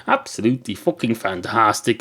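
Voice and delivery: normal talking voice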